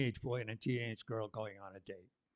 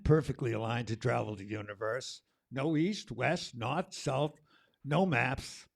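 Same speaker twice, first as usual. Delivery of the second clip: rhythmic, punchy spoken word read; spoken word poem; 2 semitones higher, 9 dB louder, slower than usual. Acted. Poet performing the summer poem for the crowd, rhythmic and bold. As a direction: ride the beat of summer.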